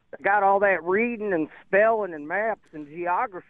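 lightly mock the type who hates reading; a hint of a sneering CNN-style caricature; mocking dumb conservative. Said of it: "which you just mimicked there".

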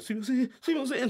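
bow and apologize over and over; meek apology. Retold or imitated. Manager apologizing to the angry producer, high meek pleading. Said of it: "金巻を振りながら".

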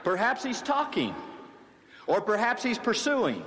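high, teasing sarcasm; mock suggestion. Elijah teasing the priests about their god, sarcastic. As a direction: dangle silly excuses for Baal. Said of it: "Elijah mocked them".

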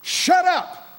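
a high, sharp "shut up" command; preacher voicing a stern inner command, pitched high. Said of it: "I'd hear it inside me".

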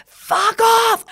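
high, loud furious yell at the birds; angry scream. Retold or imitated. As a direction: scream it at the cockatoos. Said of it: "I used to scream".

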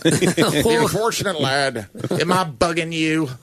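slow mock Irish brogue; hammed-up Irish lilt, drawn out; Irish accent. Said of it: "Read in Irish accent".

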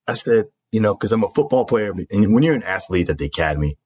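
ordinary talk

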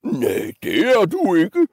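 high-swooping troll refusal; roaring troll. Acted. Troll forbidding the little goat, swooping roar. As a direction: roar it in a put-on monster voice. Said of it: "brølede trolden".

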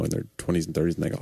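normal talking voice

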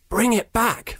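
usual speaking voice

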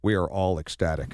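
deep, flat, lifeless drone; deadpan it with zero joy; monotone Belichick. Retold or imitated. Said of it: "in a totally monotone voice".

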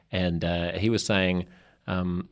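ordinary talk

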